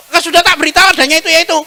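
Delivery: annoyed wife scolding her husband for asking again; irritated wife. Retold or imitated. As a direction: snap back at him, exasperated.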